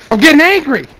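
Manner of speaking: angrier. Real, not acted. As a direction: repeat it with rising frustration.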